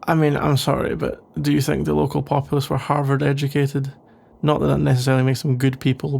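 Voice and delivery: ordinary talk, nothing marked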